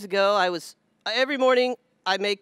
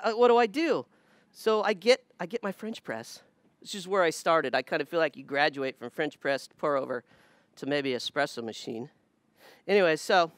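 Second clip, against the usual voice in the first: ordinary delivery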